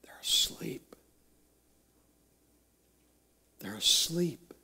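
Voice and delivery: near-whisper, very soft; hushed dismay. Acted. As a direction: whisper it softly, with long pauses. Hushed discovery of sleeping disciples.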